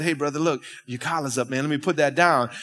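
low, soft friendly heads-up; quietly tip off a friend; discreet aside. Retold or imitated. Colleague tells preacher his collar is up, discreetly.

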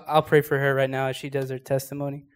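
ordinary talk

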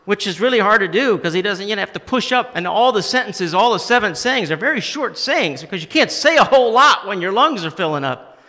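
high, loud, urgent preaching; preaching at full force. Acted. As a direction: drive it home like a shout. Preaching the cry from the cross.

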